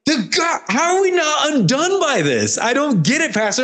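high, bewildered voice; quoting a churchgoer. Retold or imitated. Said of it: "I'm like".

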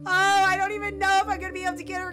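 high, loud, fast outburst; frantic worry. Real, not acted. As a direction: rush it out in a panic. Frantic over sick Sim.

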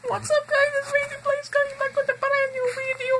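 high, loud hyped intro voice; YouTuber intro. Pretend. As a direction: announce the channel like a hype host. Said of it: "YouTube intro on request".

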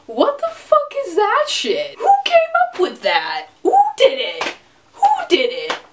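high outraged demand, a laugh breaking in. Real, not acted. Vlogger ranting at men's double standard, high outraged questions. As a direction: demand to know who, incredulous.

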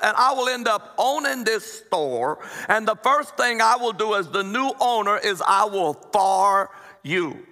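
slow, heavy Cajun drawl, rising in anger; indignant Cajun wife threatening the manager; Cajun accent. Acted. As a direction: Cajun lady building to a furious threat. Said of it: "they talk like this".

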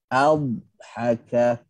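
adhaka with the dh held long on the tongue edge; held dad. Shown as a demonstration. Teacher demonstrates istitalah in adhaka. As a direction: linger on the dh of adhaka without stopping. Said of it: "dilamakan pada makhraj seolah-olah ada berhenti sekejap".

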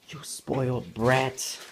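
slow, put-on teasing scold; playful fake scolding of the baby; mock scolding. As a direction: scold the baby in fun, slowly. Teasing baby after gasp.

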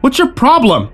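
high-pitched challenge; angry shout. A narrator's reading. Lucy confronts the man pointing a gun, angry shout. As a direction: shout it in outrage. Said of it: "she shouted".

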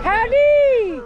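loud, high howdy shouted across; cheery call-out. Real, not acted. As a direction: hail them from a distance. Calling out to passersby.

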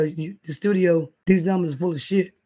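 ordinary delivery

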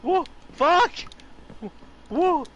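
high loud whoops; excited whooping. Real, not acted. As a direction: whoop as you jump. Excited parkour yelling.

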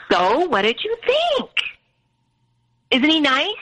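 high, slow, sugary mother asking; mother's sweet voice. Retold or imitated. Mother asking her daughter about the neighbor, in a high, slow, sugary voice. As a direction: coax like a pushy mom fishing for praise. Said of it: "And she's like".

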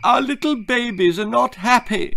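mock tearful high wail; blubbing mother. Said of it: "blubbed the stupid woman".

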